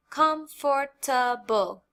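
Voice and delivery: comfortable said slowly, every syllable apart: com-for-ta-ble; four syllables. Shown as a demonstration. Teacher counting syllables in comfortable, slowly. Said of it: "here we have four syllables".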